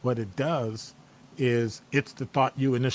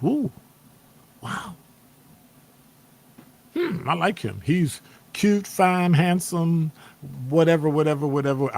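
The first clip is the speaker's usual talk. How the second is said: mock swooning woman; 5 semitones higher, 3 dB louder than usual. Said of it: "She looks and goes".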